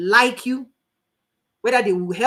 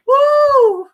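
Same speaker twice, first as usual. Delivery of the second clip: high loud whoop of glee; 11 dB louder than usual. Real, not acted. To the audience, during a laughing fit, gleeful whoop.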